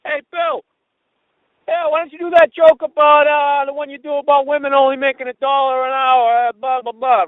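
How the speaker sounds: loud, high-pitched heckle from the crowd; heckler's yell. Retold or imitated. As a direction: holler it at the stage, imitating a drunken-style heckler. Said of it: "he just yells out".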